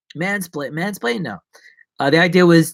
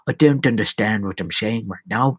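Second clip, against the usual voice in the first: ordinary talk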